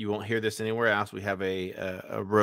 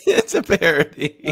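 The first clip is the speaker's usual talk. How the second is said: laughing; 7 semitones higher, 7 dB louder than usual. Real, not acted. Laughing at the clip.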